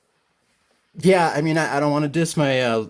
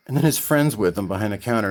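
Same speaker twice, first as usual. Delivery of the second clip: usual speaking voice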